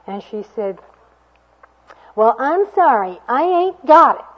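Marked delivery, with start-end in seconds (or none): 2.1-4.2 put-on high, loud, whining young woman; whiny voice. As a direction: whine it out, higher and higher. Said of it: "She had a real whiny voice".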